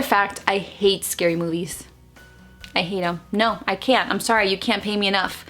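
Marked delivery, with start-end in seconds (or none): none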